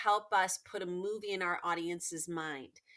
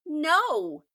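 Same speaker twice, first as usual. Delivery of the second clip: louder and higher, flat refusal; firm no; 7 semitones higher, 11 dB louder than usual. Acted. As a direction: turn him down sharply. Girl refuses again.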